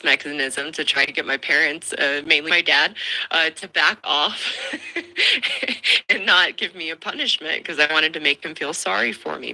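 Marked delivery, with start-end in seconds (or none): 4.8-6.0 high, airy giggle; amused laugh at oneself; short high-pitched chuckle. Real, not acted. Laughs recalling her childhood ploy.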